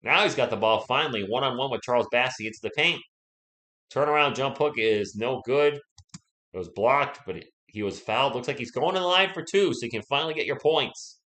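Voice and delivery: energetic commentary on a post move and foul; live play-by-play. Real, not acted. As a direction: follow the play as it unfolds.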